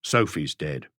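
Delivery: low, heavy husband's voice; grim husband. A narrator's reading. Husband tells his wife the girl is dead, low. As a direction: drop the news low and flat.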